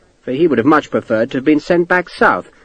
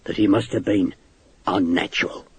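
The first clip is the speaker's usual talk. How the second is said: hushed, lower, softer confiding; 4 semitones lower, 7 dB quieter than usual. A narrator's reading. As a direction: drop the voice to share a scandal. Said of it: "Hakeswell lowered his voice".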